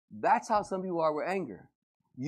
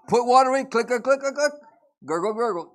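louder, higher playful clicking sounds; mock coffee machine noises; 7 semitones higher, 11 dB louder than usual. Pastor mimics coffee machine to congregation, playful louder sounds.

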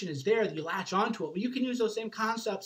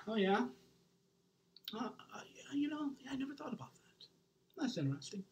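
whispered, higher, shrinking away; overwhelmed listener; 5 semitones higher, 9 dB quieter than usual. Retold or imitated. Imitating a person uneasy at his in-your-face talk, whispered. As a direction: play someone cornered by too much intensity. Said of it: "maybe I'm a little too in your face".

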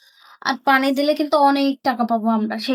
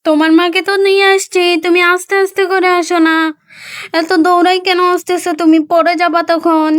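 louder call, chiding a running calf; gentle scolding; 3 semitones higher, 7 dB louder than usual. Acted.

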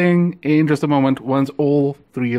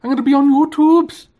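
high, excited squeaky ferret voice; 10 semitones higher, 5 dB louder than usual. Pretend. Owner voicing her ferret, thrilled to be on camera. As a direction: gush like a starstruck pet. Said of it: "She said".

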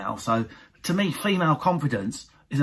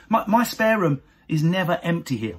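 usual speaking voice

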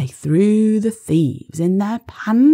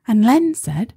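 ordinary delivery

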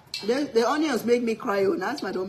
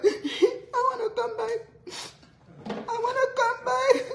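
very high mock wail; imitated crying child; 13 semitones higher than usual. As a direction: plead in a squeaky, hammed-up sob. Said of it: "If you see cry".

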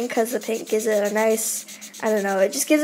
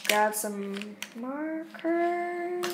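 usual speaking voice

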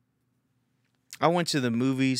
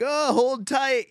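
high, loud, slow exclamation; mock alarmed cry; 6 dB louder, much slower than usual. Husband grabbing onto wife in the shaking seats, mock alarmed cry. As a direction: brace for the ride in a hammed-up yell.

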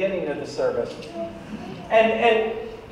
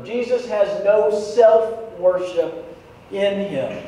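normal talking voice

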